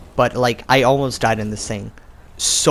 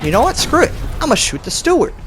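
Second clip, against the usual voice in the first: excited; 12 semitones higher, 4 dB louder, faster than usual. Retold or imitated. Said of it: "I'm like".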